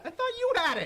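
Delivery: high, breezy Goku voice; Goku impression. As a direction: toss it off like Goku. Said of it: "That is very Goku".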